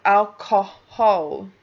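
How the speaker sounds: alcohol slow, middle and last o as in clock. Shown as a demonstration. Teacher suggesting an accent variant: slow alcohol. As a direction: draw out alcohol with clock vowels. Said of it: "o as in clock".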